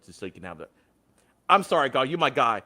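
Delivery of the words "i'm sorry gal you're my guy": loud, forceful apology to a favorite; punchy, raised-voice disclaimer; emphatic apology. Real, not acted. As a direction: come in loud before delivering bad news. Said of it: "I don't wanna say it like that".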